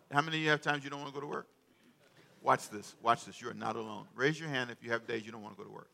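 ordinary delivery

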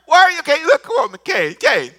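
loud, high coaxing plea; God coaxing loudly. Retold or imitated. God urging silent Cain to answer, loud and high. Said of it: "This is God talking to him".

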